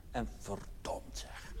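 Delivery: breathy whisper, barely audible; a hushed, sly aside. Acted. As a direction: drop to a conspiratorial whisper. Muttered aside to the audience.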